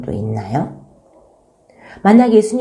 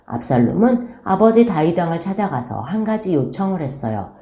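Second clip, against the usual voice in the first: ordinary delivery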